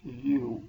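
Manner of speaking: "यू" opened on a consonant Y, like "you"; Y sound. Shown as a demonstration. Teacher showing students the sound in university, "यू" with Y sound. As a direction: say "यू" with a Y sound at the start. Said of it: "it produces the sound of".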